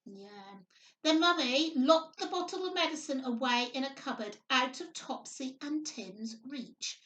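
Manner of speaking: plain reading voice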